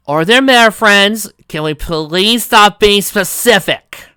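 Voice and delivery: slow, loud, fed-up yelling; exasperated shout. Acted. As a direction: drag it out, annoyed and loud. Reality check interrupts, exasperated.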